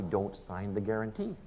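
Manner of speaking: quiet dramatic reveal after a held pause. Retold or imitated. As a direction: hold back, then quietly land the name. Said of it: "I used a dramatic pause".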